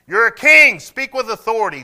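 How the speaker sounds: high, loud preacher's declaration; impassioned preaching. Real, not acted. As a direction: preach it at full force. Preaches at full force.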